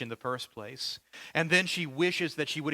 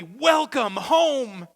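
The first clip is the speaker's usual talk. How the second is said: much higher, louder, slow drawn-out welcome shout; joyful shout; 10 semitones higher, 11 dB louder, much slower than usual. Retold or imitated. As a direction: cheer it out like a crowd at a homecoming. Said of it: "signs that say emphatically".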